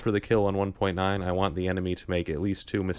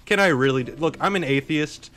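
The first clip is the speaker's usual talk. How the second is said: emphatic rant; 7 semitones higher, 5 dB louder than usual. Real, not acted. Emphatic rant about God.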